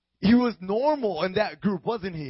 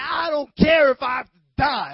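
high, loud, shouted bravado; bold; 7 semitones higher, 9 dB louder than usual. Acted. Peter boasting to Jesus he would die, bold shout. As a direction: shout the vow fearlessly. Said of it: "He was bold".

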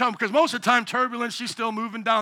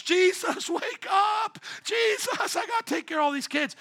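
high, frantic mock cry; father alone with kids, crying to Jesus; mock panic; 11 semitones higher than usual. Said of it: "one of those moments".

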